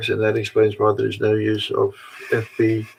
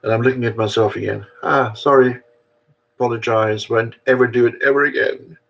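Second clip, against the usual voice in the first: ordinary talk, nothing marked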